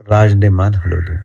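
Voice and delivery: ordinary delivery, nothing marked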